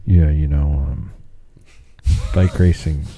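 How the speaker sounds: low, halting, defeated delivery; mock dejected announcer. Imagining him back on the mic, mock dejection. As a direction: mimic an announcer who has given up.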